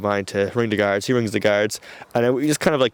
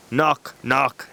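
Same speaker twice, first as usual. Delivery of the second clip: usual speaking voice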